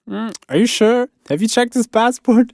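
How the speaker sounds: high, slow mock-questioning voice; imitating doubtful Londoner. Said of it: "he's from London, and he said".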